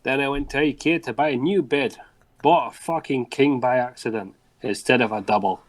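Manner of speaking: storytelling in a put-on Scottish accent. Shown as a demonstration. Co-host reading a listener's mishap to the host, Scottish accent. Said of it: "You do a good Scottish accent".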